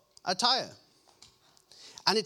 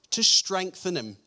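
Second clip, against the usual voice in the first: ordinary talk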